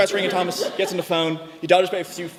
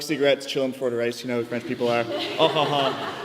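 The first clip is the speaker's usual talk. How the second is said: ordinary talk, nothing marked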